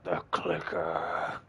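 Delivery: quiet, drawn-out monstrous villain impression; Scratch. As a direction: growl it softly and slowly, seething like a rabid animal. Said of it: "hear the seething in his voice".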